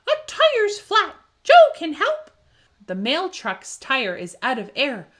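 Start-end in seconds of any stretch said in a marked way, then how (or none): 0.1-2.3 high, loud urgent puppy cry; excited yelp. A narrator's reading. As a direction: yelp it out like an eager pup. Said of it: "cries out a yelp".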